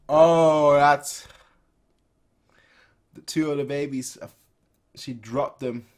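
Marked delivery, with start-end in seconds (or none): 0.1-1.3 shocked gasp. Real, not acted. Shocked reaction to the falling babies.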